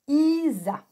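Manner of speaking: "Isa" drawn out as iii-za; stretched-out dictation. Shown as a demonstration. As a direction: sound out "Isa" slowly, syllable by syllable. Teacher dictating a syllable word. (« Isa » dicté en étirant : iii-za)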